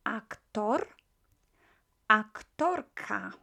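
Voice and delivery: Aktor then aktorka, rolled r; slow model words. Shown as a demonstration. Teacher modelling Aktor and aktorka. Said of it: "teacher models words".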